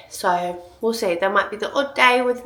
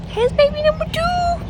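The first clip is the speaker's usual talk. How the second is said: excited; 4 dB louder than usual. Real, not acted. Showing the baby scan.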